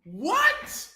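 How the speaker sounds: high, drawn-out rise of disbelief; floored by the line; stunned what. Real, not acted. Reacting to the Prince bar, stunned.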